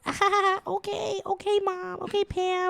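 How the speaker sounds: high, whiny okay okay Mom; brushing Mom off like a kid. Retold or imitated. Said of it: "And we're like".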